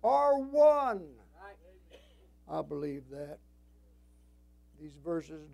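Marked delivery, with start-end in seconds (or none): 0.0-1.1 high, loud, slow scripture proclamation; full-force preaching. Real, not acted. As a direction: ring out each word to the congregation.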